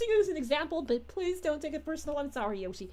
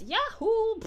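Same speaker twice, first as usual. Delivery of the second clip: a quick, higher, louder cartoon cheer; Wario impression; 8 semitones higher, 5 dB louder than usual. Detective mocking the jailed villain's catchphrase, hammed-up whoop. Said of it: "he always says".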